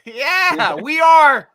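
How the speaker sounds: loud, high call of a college chant; rallying cheer. Real, not acted. Host whoops the Penn State call after the pick, rallying cheer. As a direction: shout it out to start the chant.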